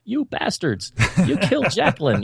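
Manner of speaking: high-pitched cartoon outcry; South Park voice. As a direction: cry it out like an outraged cartoon kid. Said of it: "saying in my South Park voice".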